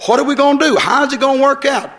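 high, anxious imitation of a fretting believer; mock worried whine. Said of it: "why come to me and say".

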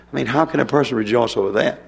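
ordinary talk

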